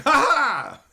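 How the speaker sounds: loud, high sarcastic fake laugh; mocking ha ha. Streamer mocking a viewer's card-theft joke with a fake laugh. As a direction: laugh right in their face, mockingly.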